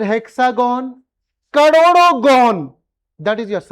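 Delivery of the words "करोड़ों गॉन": excited exaggeration. Teacher exclaims at endless polygons.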